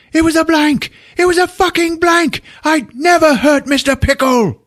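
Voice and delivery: high, loud falsetto shouting; frantic shout. Retold or imitated. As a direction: yell it out in frantic relief. Harry crying out in relief.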